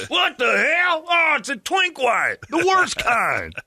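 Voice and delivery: squeaky hammed-up disgust; mock gun guy. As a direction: spit out the insult in a squeaky voice. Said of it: "mock gun guy insult".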